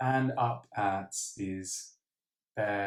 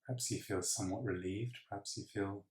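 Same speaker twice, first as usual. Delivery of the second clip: soft breathing-exercise voice, long pauses between counts; hushed, calm meditation guide; guided meditation; 4 dB quieter than usual. Acted. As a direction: count breaths quietly and evenly.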